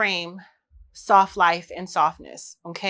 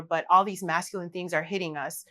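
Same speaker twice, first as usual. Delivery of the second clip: usual speaking voice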